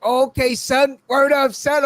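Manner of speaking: high-pitched mock New York slang; New York accent. Telling of strangers teasing his accent, mock New York talk. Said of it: "You got that accent".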